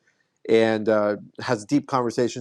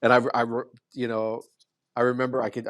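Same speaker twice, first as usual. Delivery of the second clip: normal talking voice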